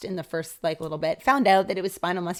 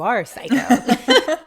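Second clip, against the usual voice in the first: laughing; 6 semitones higher, 11 dB louder, much slower than usual. Retold or imitated. Self-talk, laughing.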